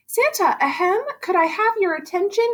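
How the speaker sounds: high, eager little elf voice. Acted. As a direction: pipe up like a keen little helper. Elf interrupts Santa politely.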